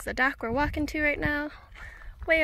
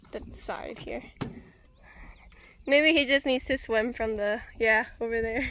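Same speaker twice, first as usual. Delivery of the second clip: ordinary talk, nothing marked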